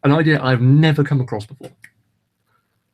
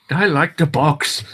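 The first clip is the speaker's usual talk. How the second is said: mock book-lover; 3 semitones higher, slower than usual. Parodying creepy character recordings.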